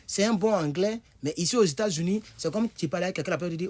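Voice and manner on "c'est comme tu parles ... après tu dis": ordinary talk, nothing marked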